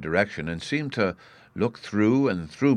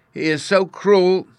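put-on high, loud childish wail; acted tearful complaint, drawn out slowly; mock sobbing; 13 semitones higher, 9 dB louder, slower than usual. A narrator's reading. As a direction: wail it like a wronged small boy. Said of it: "he sobbed".

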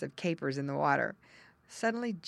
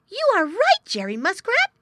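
very high, loud excited otter; high excited young otter cry; cried out; 13 dB louder than usual. Acted. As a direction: burst out in surprise. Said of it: "he cried".

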